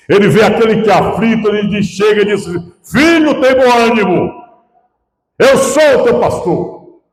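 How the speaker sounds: high-pitched, fervent pulpit delivery; impassioned preaching. Real, not acted. Pastor telling the congregation of the fold, impassioned preaching. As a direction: preach it out in long, ringing phrases.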